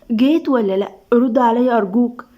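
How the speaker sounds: usual speaking voice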